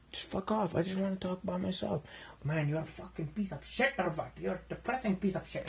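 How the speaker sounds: ordinary talk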